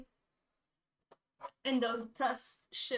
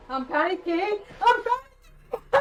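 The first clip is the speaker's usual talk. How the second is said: rising, loud, high cry; real panic; 5 semitones higher, 5 dB louder than usual. Real, not acted. Player tells chat they're panicking, cry rising. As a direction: escalate into a panicked shriek.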